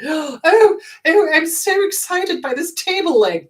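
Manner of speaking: high, loud gushing delight; mock excitement. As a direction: gush over nothing, hammed up. Mocking an overexcited person.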